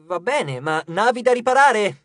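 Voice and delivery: rally the crew with forced energy; exclaiming. A narrator's reading. Leo listing jobs to the crew, exclaiming. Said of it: "esclamò Leo".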